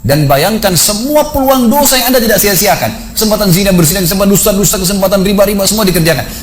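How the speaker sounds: high, insistent preacher's warning; peringatan dosa. Retold or imitated. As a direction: draw out the opening, then press through the list of sins. Memperingatkan dosa kepada peserta jenazah. (peringatan dosa yang mendesak)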